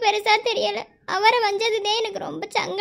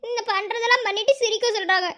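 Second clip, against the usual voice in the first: ordinary talk, nothing marked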